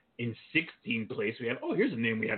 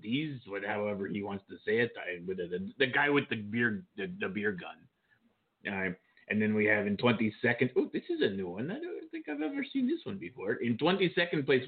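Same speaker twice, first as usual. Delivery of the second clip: normal talking voice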